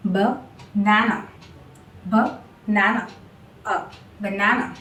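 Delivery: banana said slowly as "buh-NA-nuh", low and controlled; two schwas. Shown as a demonstration. Teacher modelling banana's two schwas, slow and low. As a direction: keep the first and last A of banana a light "uh". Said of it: "two schwa sound are involved".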